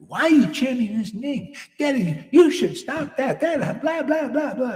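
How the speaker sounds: high, loud mock nagging; mocking scold. Critics nagging a devotee for chanting, mock scolding, high. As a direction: whine it as the annoyed critics. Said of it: "when they be li".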